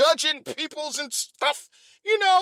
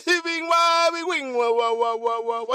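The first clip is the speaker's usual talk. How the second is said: mock baby wail; 3 semitones lower than usual. Baby crying imitation.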